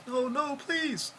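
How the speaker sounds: high, loud terrified begging; desperate pleading. Retold or imitated. Victim begging the beating officers to stop, screamed terrified plea. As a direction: cry out for your life. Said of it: "as Mister Brown's pleading for his life".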